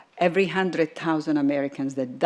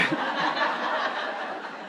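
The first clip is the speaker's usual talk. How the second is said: breathless laugh after the joke; 7 dB quieter than usual. Real, not acted. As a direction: laugh along with the room. Joke about stupid people.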